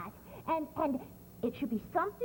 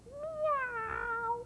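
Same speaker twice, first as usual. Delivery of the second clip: high squeaky meow; cat meow; 12 semitones higher than usual. Shown as a demonstration. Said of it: "sound like a cat".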